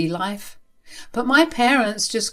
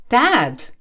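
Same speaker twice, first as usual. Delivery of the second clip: higher, louder one-word exclamation; shocked protest; 5 semitones higher, 5 dB louder than usual. Acted. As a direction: cry out in protest at the father. Daughter protests at father's rudeness.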